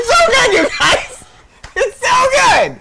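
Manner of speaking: loud, high, drawn-out rave; over-the-top fan enthusiasm; gushing. Real, not acted. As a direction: stretch it out in a high, loud plea.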